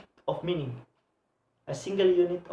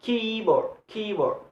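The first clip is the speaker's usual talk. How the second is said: "keyboard" with KEY higher, board dropping away; stress on KEY; 4 semitones higher than usual. Shown as a demonstration. Teacher showing compound noun stress, raised KEY in "keyboard". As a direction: lean on the first syllable, KEY-board. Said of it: "So key have a stress".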